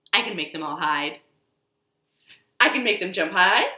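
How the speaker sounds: ordinary talk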